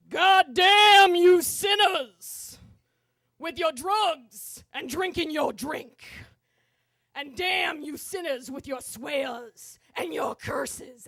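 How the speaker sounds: imitated subway preacher, loud and drawn out; fire-and-brimstone preacher, stretching the stressed words; booming sermon voice. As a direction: thunder slow damnation at the sinners. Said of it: "he's got this melodious voice".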